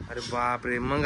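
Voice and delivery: low, soft hushed "अरे बापरे"; mock shock. Looking at her leg, hushed low dismay. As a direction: drop low and quiet in dismay. Hurt child shown to viewers.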